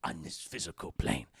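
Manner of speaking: very low, slow, hoarse Yoda croak. Retold or imitated. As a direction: sink the voice down like a tired little old master. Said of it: "He's like".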